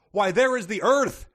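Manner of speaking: loud, high-pitched cry of discovery; hammed-up shout of surprise; mock exclamation. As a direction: call it out like spotting land. Reading out an exclamation.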